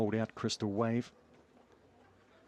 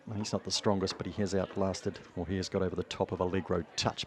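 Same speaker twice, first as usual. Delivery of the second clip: usual speaking voice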